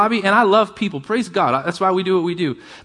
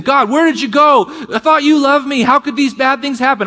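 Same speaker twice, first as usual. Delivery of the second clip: higher and louder, a pleading cry to God; anguished plea; 8 semitones higher, 4 dB louder than usual. Retold or imitated. Doubting believer crying out to God, anguished plea. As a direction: cry out like someone who feels abandoned.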